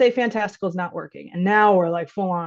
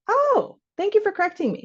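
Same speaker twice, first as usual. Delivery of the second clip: sugary parent voice; 12 semitones higher than usual. Acted. Parent answers child sweetly.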